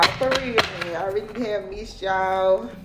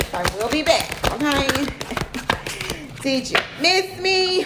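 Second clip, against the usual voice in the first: TV host voice; 6 semitones higher than usual. Pretend. Playful high TV host voice, teasing her viewers. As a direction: ham it up like a TV presenter. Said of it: "I don't know why I said that, y'all. I be like, okay, too much T V".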